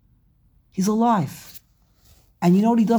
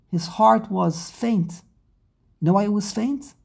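normal talking voice

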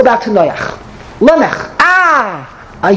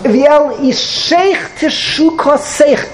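high, slow, drawn-out chant of the verse; mock torturous verse; 6 semitones higher, much slower than usual. Guest reciting a curse verse to the bride, slow and drawn out. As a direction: drag the verse out as if it pains her. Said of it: "It should be with torture".

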